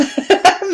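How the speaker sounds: high bright burst of laughter; a happy squeal of a laugh; delighted laugh. Real, not acted. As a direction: laugh with glee at the memory. Laugh after Damn.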